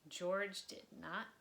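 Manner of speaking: expressive read-aloud storytelling; lively children's book narrator; story narration. A narrator's reading. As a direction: read it like bedtime story time for kids. Reading a picture book aloud.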